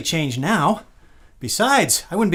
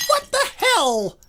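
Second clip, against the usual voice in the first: high, slow outraged cry; shocked and stung; shocked outrage; slower than usual. Acted. Gerald stunned after the dog bites him.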